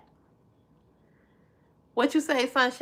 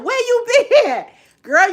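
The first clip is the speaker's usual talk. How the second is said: high laughing where you been; excited greeting; 12 semitones higher, 6 dB louder than usual. Real, not acted. Greeting a returning viewer, high excited laugh. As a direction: squeal a welcome.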